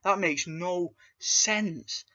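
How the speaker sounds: mock disbelief, whispered high and very slow; incredulous aside. Reviewer recalling his reaction to the jaw scene, incredulous whisper. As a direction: hiss out how stupid it is. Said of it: "I'm like".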